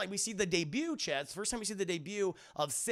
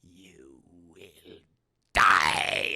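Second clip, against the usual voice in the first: Emperor; 9 semitones lower, 5 dB louder, much slower than usual. Retold or imitated. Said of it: "he's like".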